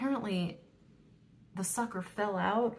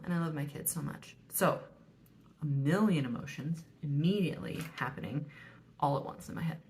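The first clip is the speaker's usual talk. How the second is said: usual speaking voice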